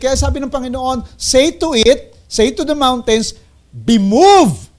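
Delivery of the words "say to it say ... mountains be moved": each word clear and firm, louder at the end; firm proclaiming voice; emphatic command. Acted. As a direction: command the mountain to move. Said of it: "speak clearly".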